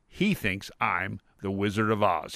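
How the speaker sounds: ordinary delivery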